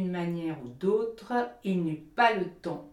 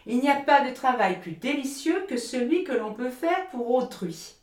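loud, lofty sermonising miller; the miller scolding Hans for laziness, loud and preachy; lecturing miller; 8 semitones higher, 8 dB louder than usual. Acted. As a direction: preach at him with self-righteous force.

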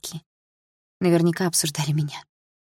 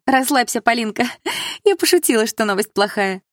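high, louder, excited teasing; gleeful reassurance; 6 semitones higher, 4 dB louder than usual. A narrator's reading. Friend admits bad news was a joke, gleeful. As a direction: tell her it was a joke, bursting with glee.

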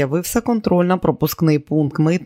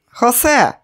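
loud, high call; 8 semitones higher, 5 dB louder than usual. Acted. Border guard calling his captain over, loud. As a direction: shout across to the booth.

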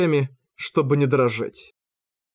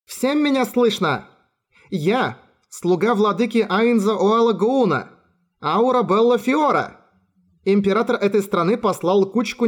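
put-on dark elf girl shouting; 6 semitones higher than usual. A narrator's reading. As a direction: proclaim it high over the courtyard. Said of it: "раздался голос".

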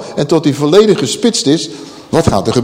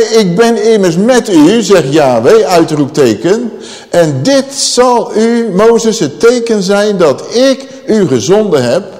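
higher, louder and slow at first; God speaking with a raised voice; 5 semitones higher, 4 dB louder than usual. Acted. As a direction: proclaim it with an exclamation mark. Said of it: "Uitroepteken. Dus God die spreekt het met stemverheffing".

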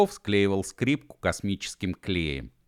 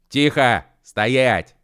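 loud, higher barked orders; commanding shout; 5 semitones higher, 8 dB louder, much slower than usual. Acted. Mashkov stops the fight, loud commanding shout. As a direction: freeze everyone with a command. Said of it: "командорским голосом закричал Машков".

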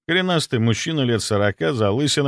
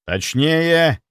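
raised, insistent order; demanding; 6 semitones higher, 4 dB louder than usual. A narrator's reading. Captain pressing the scanner operator for details, demanding. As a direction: demand more detail at once. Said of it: "потребовал Стрельцов".

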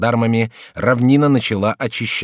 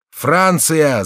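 higher-pitched, mildly raised cry; 7 semitones higher, 4 dB louder than usual. A narrator's reading. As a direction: call it out across a distance. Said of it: "закричал всадник". (выкрик, повыше и чуть громче)

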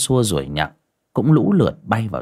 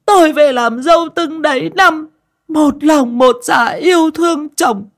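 high, loud whining daughter-in-law voice, slowing at the end; sniffling complaint; 6 dB louder than usual. Acted. Daughter-in-law complaining to husband and workers, high fake-tearful whine. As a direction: fake tearful self-pity before the whole family. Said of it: "Mụ sụt sùi rồi dầu mỏ".

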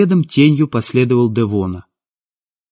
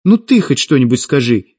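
losing patience; 2 semitones higher, 4 dB louder than usual. A narrator's reading. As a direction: burst out, demanding some support. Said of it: "не выдержала Светлана".

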